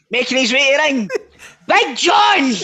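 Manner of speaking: playful ring announcer bellow; mock ring announcer.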